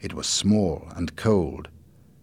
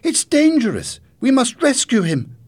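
high, loud urgent appeal; alarmed cry; 9 dB louder than usual. A narrator's reading. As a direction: plead loudly for a rescue. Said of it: "she cried".

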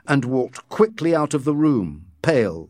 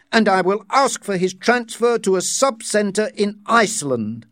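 stern director voice; 10 semitones higher than usual. Acted. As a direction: denounce him before the room. Director denounces Bernard.

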